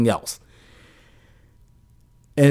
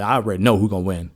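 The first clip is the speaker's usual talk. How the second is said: usual speaking voice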